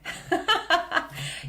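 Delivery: high-pitched laugh; amused, high giggle at your own whispering. Real, not acted. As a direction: burst out laughing at yourself.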